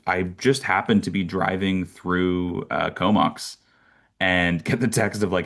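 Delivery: usual speaking voice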